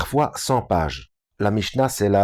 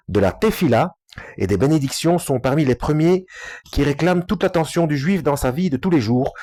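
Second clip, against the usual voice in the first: higher, measured read-aloud in French; fluent reading aloud; 5 semitones higher than usual. Real, not acted. Reading the French translation to listeners, fluent read-aloud. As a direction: read the translation out clearly and smoothly.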